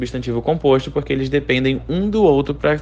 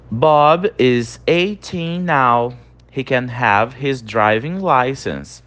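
slow model reading; much slower than usual. Shown as a demonstration. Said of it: "Então, Bob tem dezoito anos agora".

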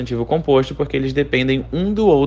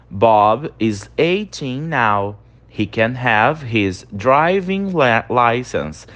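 slow model reading; 2 semitones lower, much slower than usual. Shown as a demonstration. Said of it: "Então, Bob tem dezoito anos agora".

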